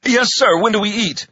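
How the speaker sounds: put-on young recruit voice. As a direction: pipe up at the sergeant. Recruit answers the sergeant.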